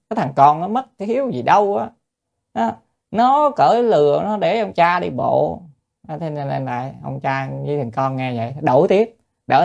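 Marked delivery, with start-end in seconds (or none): 0.1-5.6 onlooker scolding. Retold or imitated. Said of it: "người ta nói".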